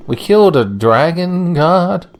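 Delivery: hammed-up disbelief; mock dismay. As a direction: act shocked at the accusation. Mock dismay at game event.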